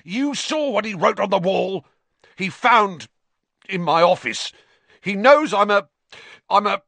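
high, loud, halting outrage; hysterical, stumbling tirade; spitting rage. A narrator's reading. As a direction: accuse at a high pitch, breaking off. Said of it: "Filch spat".

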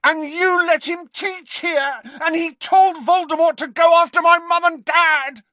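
screaming high and loud at the headmaster; boy yelling in furious accusation. A narrator's reading. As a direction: let the rage break out fully. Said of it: "he could not stop himself".

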